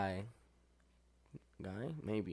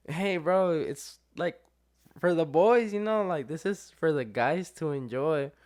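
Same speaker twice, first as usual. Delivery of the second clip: excited pleading; 6 semitones higher, 6 dB louder than usual. Retold or imitated. Pleading with buddy over photo.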